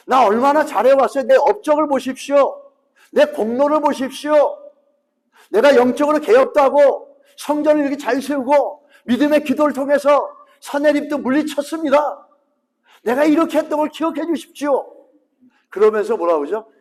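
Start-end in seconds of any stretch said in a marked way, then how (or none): 0.1-12.3 raised, louder pleading that lists his deeds; high, loud self-righteous plea to God; Hezekiah's boastful prayer. Acted. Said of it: "하나님". 13.0-15.1 a king proudly reminding God of 이렇게; boastful plea. Acted. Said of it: "하나님".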